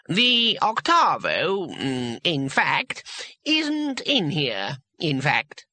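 put-on high, drawn-out, hesitant old voice; slow. A narrator's reading. Wizard explains about the Octavo, slowly. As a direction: stretch key words, faltering between phrases. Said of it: "said the voice slowly".

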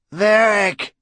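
high shouted name. A narrator's reading. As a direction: call out his name sharply. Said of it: "he shouted at the man".

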